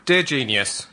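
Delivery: ordinary delivery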